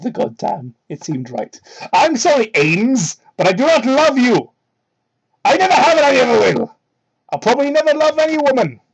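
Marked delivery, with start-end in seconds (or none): 1.9-8.8 high, loud melodramatic declaration; hammed-up Sonic. Sonic telling Amy he never loved her, melodramatic. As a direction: declaim it like a soap hero.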